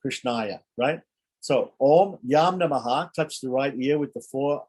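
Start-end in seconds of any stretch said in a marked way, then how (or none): 1.8-3.1 slow mantra with a lifted Om; slow intoned mantra. Shown as a demonstration. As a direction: chant it slowly and clearly. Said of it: "recites the mantra".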